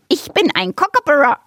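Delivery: high, cheeky bird voice; kookaburra bird voice. Acted. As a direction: chirp it like a cocky bird. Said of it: "erwiderte der Vogel".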